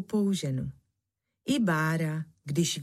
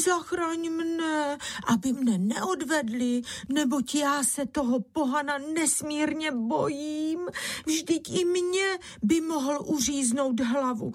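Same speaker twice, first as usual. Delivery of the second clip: naříkání; 9 semitones higher than usual. A narrator's reading. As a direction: plead and lament in a high voice. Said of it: "jala se naříkati Bára". (vysoké, táhlé bědování)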